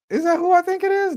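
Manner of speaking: 1 surprised recognition. Real, not acted. Spotting a guest in chat.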